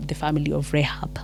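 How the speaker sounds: hushed, breathless reminder; whisper. Acted. Joshua reminding the spies, in a whisper. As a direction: whisper the name like a secret order.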